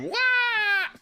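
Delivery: very high-pitched "waah"; imitated wheelie wail. Rider mocking a reckless wheelie with a high imitated wail. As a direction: squeal out a mock wail.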